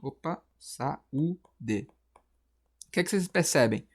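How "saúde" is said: "saúde" softly in pieces, sa... ú... de; separated syllables. Shown as a demonstration. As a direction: break "saúde" into three slow syllables. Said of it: "Só que se eu separar aqui".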